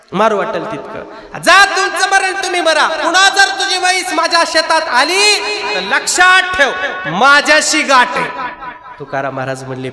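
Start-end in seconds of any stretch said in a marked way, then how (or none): 1.4-8.3 high, loud, threatening shout; angry threat. Acted. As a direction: bark the warning at him. Mambaji threatening Tukaram. (धमकी देत चढ्या आवाजात ओरडणे)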